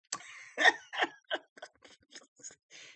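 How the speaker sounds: a burst of high, loud laughter. Real, not acted. Vlogger laughing at her own story, loud laugh. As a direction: crack up at your own story.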